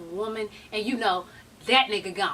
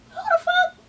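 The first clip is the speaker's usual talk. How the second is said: shrieked disbelief. Real, not acted. Outraged at the booking.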